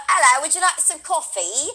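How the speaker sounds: a playful, not-quite-placeable British voice; playful British accent. Acted. Fooling around at home with her sister, playful British accent. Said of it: "when I'm fucking around here at home with my sister".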